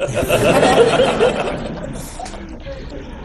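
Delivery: long high unvoiced laugh. Real, not acted. Mother Angelica laughing as she tells about the sisters asking. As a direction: laughs freely at her own punchline.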